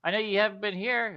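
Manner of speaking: high, loud mocking sarcasm; sarcastic. Juwila teasing vague Scratch: high loud sarcasm. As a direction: roll your eyes at him out loud.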